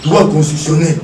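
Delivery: normal talking voice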